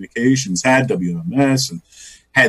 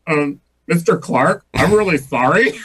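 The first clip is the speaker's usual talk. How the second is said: little puppy; 2 semitones higher than usual. Acted. Impostor apologizes to Dick Clark, little puppy voice. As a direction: confess meekly like a little puppy. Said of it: "I become this little puppy".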